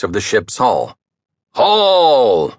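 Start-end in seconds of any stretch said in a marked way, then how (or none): 1.5-2.5 loud bellow across the deck; bellowed order. A narrator's reading. Pirate officer bellowing at the crew, loud. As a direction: bellow the command to haul. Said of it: "bellowed a voice".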